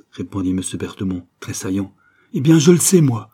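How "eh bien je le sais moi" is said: loud, high exclamation; defiant outburst. Acted. Mother confronting the men with what she knows, defiant outburst. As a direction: burst out with it, voice thrown up.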